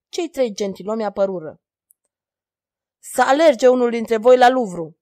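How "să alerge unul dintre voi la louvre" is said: louder command shaking with rage; trembling fury. A narrator's reading. King ordering his gentlemen in a rage, furious. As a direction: order them out, voice shaking with anger. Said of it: "furia o făcea să tremure".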